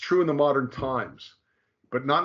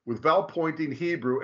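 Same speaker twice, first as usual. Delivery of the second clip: normal talking voice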